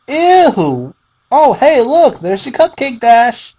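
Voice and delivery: very high, loud and sing-song; bubbly girlish mock-cheer at the victim; giddy taunt. Pinkie taunts her victim.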